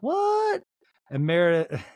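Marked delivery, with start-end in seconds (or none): 0.0-0.6 high, squealed one-word reaction; mock shock. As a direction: shriek it in comic disbelief. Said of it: "I was like".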